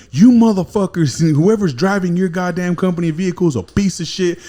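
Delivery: high, loud outraged tirade; imitating the wife ranting down the phone; retold wife tearing into the gym on the phone, high and loud; furious rant. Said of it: "And she was like".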